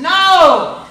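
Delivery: high, loud outraged no; indignant refusal. Retold or imitated. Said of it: "pastor refuses loudly".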